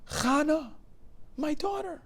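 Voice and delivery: very high, loud cry of recognition; overwhelmed father's high-pitched outcry; anguished cry. A narrator's reading. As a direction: cry out to a long-lost daughter. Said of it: "one word escaped the old man's throat".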